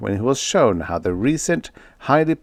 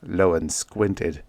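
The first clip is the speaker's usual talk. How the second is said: ordinary delivery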